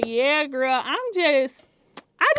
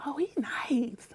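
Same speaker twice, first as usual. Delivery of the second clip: whisper; 9 dB quieter, slower than usual. Retold or imitated. Friend's remark, retold.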